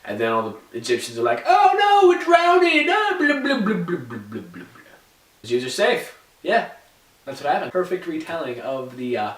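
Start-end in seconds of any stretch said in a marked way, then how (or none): 1.5-2.9 high, loud mock screaming; hammed-up drowning panic. Egyptians as the sea closes, hammed-up panicked yell. 2.9-4.6 mock drowning gurgle.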